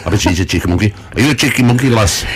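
lightly voiced northern puppet, flattering; a hint of a broad northern English accent, sweet-talking; northern English monkey voice. Puppet monkey compliments Natalie in a light northern English accent. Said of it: "started speaking with northern accent".

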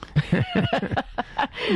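high, light chuckle; amused, high-pitched laugh. Real, not acted. Broma sobre el San Francisco. (risa aguda y divertida)

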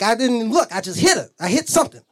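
ordinary talk

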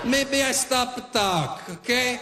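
put-on Italian dad accent. Italian dad threatens line cutter in his accent. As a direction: lay on the Italian accent and laugh through the threats. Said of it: "My Italian dad right away".

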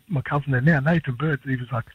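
ordinary talk

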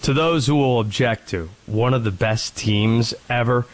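"teams" leaned on and weighted; stress on teams. Real, not acted. Host answering doubters, stress on "teams". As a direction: hit the word "teams" hard. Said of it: "there's the emphasis on the word team".

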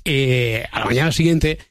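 usual speaking voice

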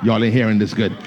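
loud, driving sermon voice; full-force preaching. Acted. Preacher pushing the point home to the congregation, loud. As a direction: press it home to the church.

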